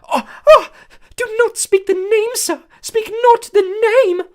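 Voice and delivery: begging in terror; moaning elf. A narrator's reading. House elf hearing the dark name, high frightened moan.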